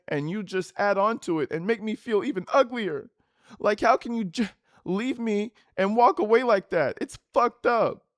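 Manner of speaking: put-on whiny girlfriend. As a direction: whine it like a wounded girlfriend. Reading her texts aloud.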